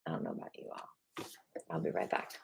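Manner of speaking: soft unvoiced aside; whispered aside. Real, not acted. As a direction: whisper it off mic. Stepping away aside.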